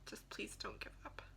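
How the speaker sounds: real tears, whispered and very soft; pleading through tears, barely audible; tearful whisper. Real, not acted. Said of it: "which is why I'm emotional".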